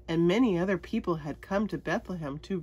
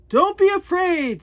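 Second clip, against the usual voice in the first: high, loud angel proclamation; booming angel; 11 semitones higher, 11 dB louder than usual. Acted. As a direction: call it out like a herald. Angel's big announcement.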